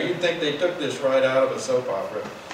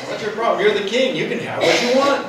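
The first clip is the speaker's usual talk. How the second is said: laughing mock taunt; 6 semitones higher, 5 dB louder than usual. Retelling Jezebel's taunt, laughing.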